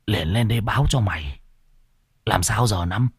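a hint of nausea and dread, kept hushed; hushed fear. Bảy telling Năm about the skull in the pot, hushed and scared. Terrified brother reports skull.

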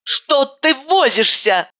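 high, impatient complaint, slow; indignant. A narrator's reading. Narrator grumbles at roommate's slow exam, indignant. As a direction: grumble at her slowness. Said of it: "возмутилась я".

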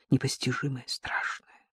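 eerie narration whisper. A narrator's reading. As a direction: drop to a hushed whisper.

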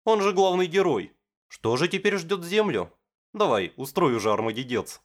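high, eager fan chatter; excited girl voice. Acted. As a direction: gush about the show. Misaki retelling anime plot excitedly.

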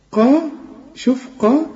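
قا with the fullest heaviest ق; the heaviest possible قا; heavy ق. Shown as a demonstration. Teacher to student: show the heaviest ق sound. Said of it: "أعلى درجات التفخيم".

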